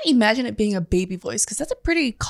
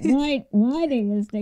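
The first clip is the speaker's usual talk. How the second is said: inside friend voice; 4 dB quieter than usual. Acted. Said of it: "You're like a ventriloquist with your own mouth".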